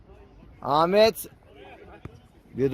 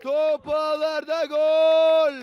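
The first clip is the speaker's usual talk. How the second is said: drawn-out goal call, pitched up; screaming football commentator; 3 semitones higher than usual. Real, not acted. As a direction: call the goal at full stretch. (bağıran futbol spikeri)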